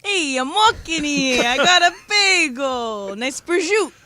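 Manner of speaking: put-on high, drawn-out Jersey Italian accent; slow, hammy Italian-American wiseguy accent. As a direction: ham up a mob-movie Italian accent, stretching the words. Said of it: "Have you heard [name]'s Italian accent?".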